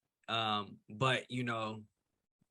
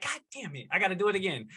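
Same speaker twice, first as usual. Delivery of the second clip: frustrated outburst; 8 semitones higher, much faster than usual. Retold or imitated. Acting out frustration while editing.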